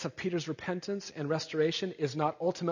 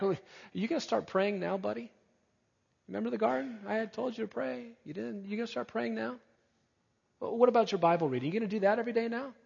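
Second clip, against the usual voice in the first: higher-pitched needling questions, hammed-up; mock nagging; 5 semitones higher, faster than usual. Imagined Jesus quizzing Peter on praying and Bible reading, mock nagging. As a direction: play at checking up on a lapsed friend.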